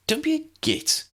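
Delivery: put-on high, firm young woman; Ginny's voice. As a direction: scold him like a sharp sister.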